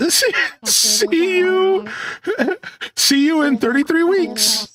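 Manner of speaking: words broken by real laughter; cracking up mid-sentence; laughing. Real, not acted. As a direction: giggle through the line. Laughs while reading his note.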